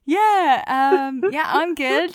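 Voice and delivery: high, flustered small talk; starstruck. Retold or imitated. Actress answering Robert Redford, starstruck and flustered. Starstruck reply to famous director.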